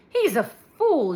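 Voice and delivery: loud, slow scornful dismissal. A narrator's reading. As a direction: spit it out with contempt. Georgette scorns the joke.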